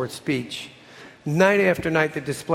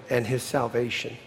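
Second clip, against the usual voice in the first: softer and lower, almost aside; 4 semitones lower, 5 dB quieter than usual. A narrator's reading. Darwin adding softly to Lady Hope in a lower tone. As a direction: drop it down gently. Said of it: "adding in a lower tone".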